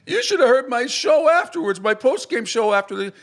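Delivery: hoog en overdreven geëmotioneerd, spottend nagedaan; hammed-up high whiny mimicry of an emotional host; mocking Clay Travis. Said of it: "He's like".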